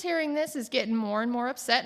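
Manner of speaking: normal talking voice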